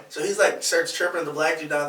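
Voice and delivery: ordinary talk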